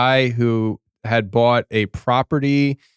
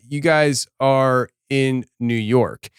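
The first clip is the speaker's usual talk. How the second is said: normal talking voice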